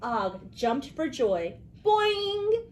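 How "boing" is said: high loud frog boing. A narrator's reading. Frog Og jumping for joy, loud high boing.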